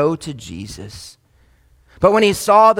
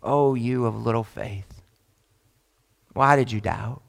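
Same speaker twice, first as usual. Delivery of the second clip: gentle rebuke; 8 semitones lower, 7 dB quieter than usual. Retold or imitated. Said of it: "simply says this".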